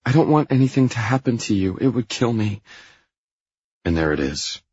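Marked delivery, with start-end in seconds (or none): 0.0-2.6 high, pleading voice for Anna; concerned Anna. Acted. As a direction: plead anxiously in a higher voice.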